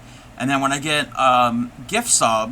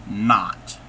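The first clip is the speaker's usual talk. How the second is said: usual speaking voice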